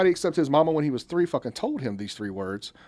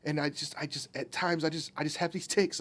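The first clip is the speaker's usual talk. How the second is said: apologetic; 9 dB quieter than usual. Retold or imitated. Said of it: "all apologetic".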